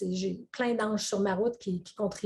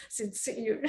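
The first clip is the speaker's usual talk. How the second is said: laughing under breath; 8 dB quieter than usual. Real, not acted. Mock serious self-talk.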